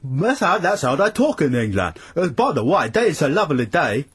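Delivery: high, hammed-up fake British accent sliding into Stavros; bad British accent. Host parodying an actor's England, bad British accent. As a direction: overdo a mangled mock-English accent. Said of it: "it's all going all Stavros now".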